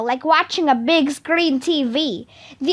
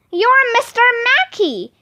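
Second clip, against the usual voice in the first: put-on high-pitched kid calling out; shouted; 8 semitones higher, 3 dB louder than usual. A narrator's reading. Neil exposing the disguised reading specialist, shouted. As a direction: pipe up high in a accusing shout. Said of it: "Neil the nude kid shouted".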